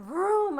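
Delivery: a loud, high imitated thunder boom; vocal thunder effect; thunder vroom. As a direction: shout the thunder noise.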